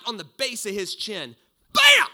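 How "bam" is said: loud high shouted bam; punch sound effect. Retold or imitated. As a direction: slam out the impact of the punch. Punch lands in retold fight.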